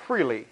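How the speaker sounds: "freely" set apart after a pause, low and falling; emphasis on freely. Shown as a demonstration. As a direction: isolate "freely" as the key word. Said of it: "Emphasis on the word".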